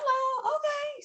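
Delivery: very high, drawn-out oh okay; surprised okay. Retold or imitated. To the co-pastor, a very high puzzled okay. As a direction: squeak it out, baffled but agreeing. Going along, baffled.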